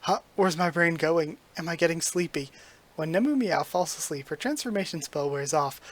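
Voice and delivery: usual speaking voice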